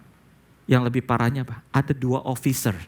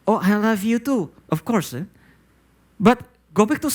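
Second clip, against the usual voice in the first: lightly voiced father, higher, a touch surprised; the half-woken dad, gently pitched up; father's voice; 7 semitones higher than usual. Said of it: "Terus papanya bilang".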